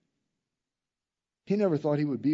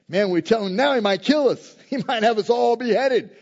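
loud, higher, mock-fearful outburst; acted alarm; 7 semitones higher, 8 dB louder than usual. Attendants weighing telling David the child is dead, mock alarm. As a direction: raise voice and pitch in playful alarm.

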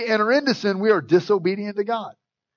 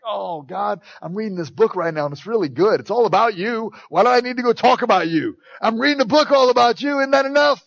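rising, louder protest to God; whining excuse; 3 semitones higher, 5 dB louder than usual. Pretend. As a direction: plead your way out of the task. Arguing with God's prompting.

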